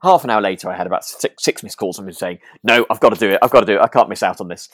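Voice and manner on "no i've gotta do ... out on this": loud, urgent imitation of the friend; loud mock insistence; insistent retold plea. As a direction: belt it like a friend who won't miss out. Friend insisting on being best man.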